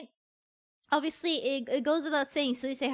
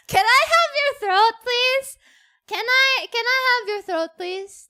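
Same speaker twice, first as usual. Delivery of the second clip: high, loud, laughing through the begging; laughing plea; 8 semitones higher, 9 dB louder than usual. Real, not acted. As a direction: beg playfully while laughing.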